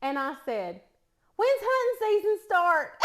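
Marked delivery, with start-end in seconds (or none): 1.4-2.9 put-on thick East Tennessee country accent, high and loud; country slip. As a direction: blurt it out in broad East Tennessee twang. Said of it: "I said it just like that".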